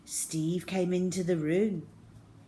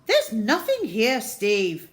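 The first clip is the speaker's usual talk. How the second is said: louder, firm no-nonsense aunt; 4 semitones higher, 8 dB louder than usual. Acted. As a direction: settle the child down firmly. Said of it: "she said firmly".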